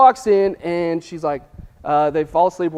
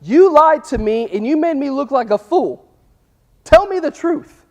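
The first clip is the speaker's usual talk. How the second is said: pitched higher, a touch of angry accusation in the words "You lied to me"; mock indignant demand; 7 semitones higher, 3 dB louder than usual. Preacher voicing Delilah scolding Samson after the failed trap, mock indignant. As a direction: play Delilah hurt and demanding, higher and louder. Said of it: "Her response to this situation was".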